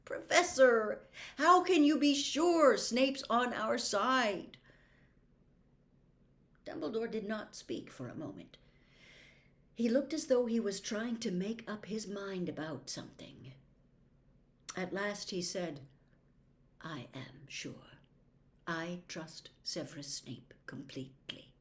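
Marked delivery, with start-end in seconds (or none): 0.0-4.6 loud, higher pressing demand; insistent anger. Acted. Harry presses Dumbledore angrily. 16.8-21.5 hushed firm assurance; quiet certainty. Acted.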